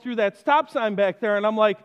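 ordinary delivery

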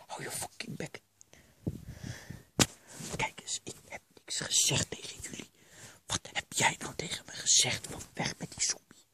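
hushed, voiceless bickering; whispered quarrel. Acted. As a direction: argue in a stage whisper. Whispered quarrel while hiding.

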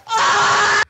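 high, loud cry; frantic yell. Real, not acted. Friend being attacked, yelling in panic. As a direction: shriek in alarm.

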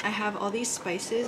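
ordinary delivery

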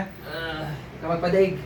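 steady him gently; gentle encouragement. Real, not acted. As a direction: healer encouraging Percival not to give in. Healer encouraging patient Percival.

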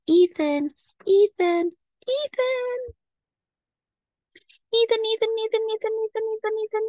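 rising, high desperate calls of a name; calling out. Acted. Girl alone in brother's room calling his name, rising high calls.

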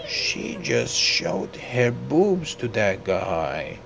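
ordinary talk

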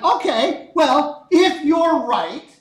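loud, high, emphatic challenge; acted counter-challenge. Poisson improvising a test to disprove his rival, loud emphatic challenge.

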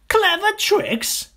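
high, loud scornful squeal; mocking cry. Small crocodile mocks big one's clever tricks, high scornful cry. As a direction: scoff at him in a squeaky little voice. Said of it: "cried the not-so-big one".

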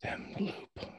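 hushed whisper, no voice; confused father whispering for his son. Acted. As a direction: whisper your son's name. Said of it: "Eugene whispering".

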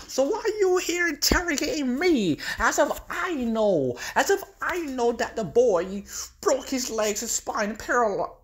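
high taunting voice; mocking Joker. Joker mocking Batman about Robin. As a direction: taunt him, sing-song and high.

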